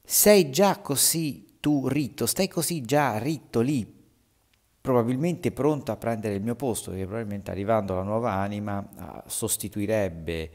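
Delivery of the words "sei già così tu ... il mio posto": shouted cry. Acted. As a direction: cry out at the newcomer in the hole. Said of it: "Ed egli gridò".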